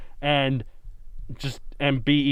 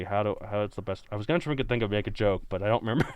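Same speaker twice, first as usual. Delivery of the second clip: ordinary talk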